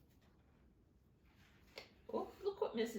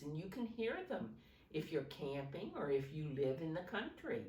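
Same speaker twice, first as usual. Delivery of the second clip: usual speaking voice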